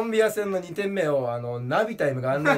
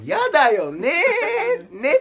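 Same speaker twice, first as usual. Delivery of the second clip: high, slow, stretched-out "yada yo ne"; whiny catchphrase; 7 dB louder, much slower than usual. Acted. Comedian sets up his catchphrase, much higher and slower whine. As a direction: draw it out high and pleading. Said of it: "っていうね".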